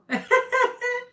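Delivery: loud high cackle; high burst of laughter. Real, not acted. Reader laughing with viewers over shared hardship. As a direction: laugh out loud, shrill and free. Laughing about surviving towers.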